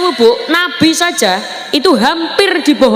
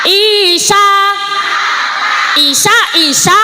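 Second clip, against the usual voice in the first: calling out; 8 semitones higher, slower than usual. Acted. Devil calling out to Jesus.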